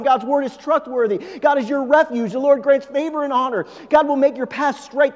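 a preacher reading promise after promise at speed; rapid promise declaration. Acted. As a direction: fire each promise off quickly, building energy.

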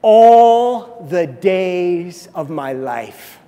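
All drawn out slow and loud; ALL stretched, very loud; stress on all. Shown as a demonstration. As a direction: lean hard and slow on All. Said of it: "underline the word all".

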